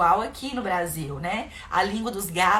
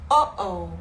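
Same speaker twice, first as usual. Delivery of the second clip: a louder sing-song oh oh; playful uh-oh; 3 dB louder, much slower than usual. Shown as a demonstration. Parent to child who did something wrong, playful uh-oh. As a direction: tease a child over a small mistake. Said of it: "você fala".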